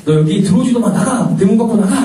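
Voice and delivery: high-pitched acted scolding; mock angry yell. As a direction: imitate an irate pastor ordering someone out. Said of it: "막 소리를 지르시더니".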